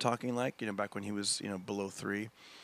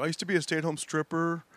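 stand-up bit delivery; 5 semitones higher than usual. Retold or imitated. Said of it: "I go".

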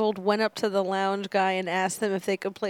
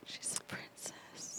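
whisper; 20 dB quieter than usual. Real, not acted. Cooing over cat.

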